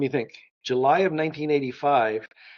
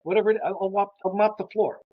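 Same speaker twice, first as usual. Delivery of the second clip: higher-pitched, eager to take anything; retold eagerness; 6 semitones higher than usual. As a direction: re-enact jumping at any job. Eagerly accepts any job offered.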